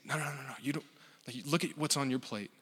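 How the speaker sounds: soft, tender correction; voicing a patient, quiet reassurance; gentle persuasion. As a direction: coax them softly toward the gift. Jesus gently offering, not pushing.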